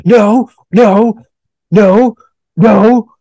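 loud, high "no no no"; mock outraged crowd. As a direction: shout them down like an angry crowd. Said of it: "everyone was just like".

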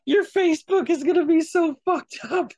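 laughing while teasing. Real, not acted. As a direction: giggle through the line. Teasing friend about his algorithm.

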